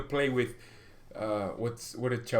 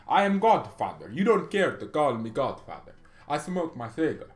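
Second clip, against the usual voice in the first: hammed-up Italian mafia boss accent in English; a lightly done Italian godfather, lazy and menacing; playful Italian-accented godfather, only lightly put on; mock Italian English. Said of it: "speaking, uh, Italian English".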